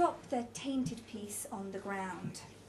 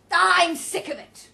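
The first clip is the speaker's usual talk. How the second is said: loud, slow, higher complaint; exasperated, raised voice; fed-up outburst; 7 semitones higher, 14 dB louder, slower than usual. A narrator's reading. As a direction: declare it loudly, dragging the words. Roger is fed up.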